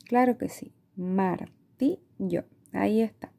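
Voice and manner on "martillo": very slow, split into syllables. Shown as a demonstration. Teacher checking the first syllable, very slow and syllabic. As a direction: draw out Martillo one syllable at a time.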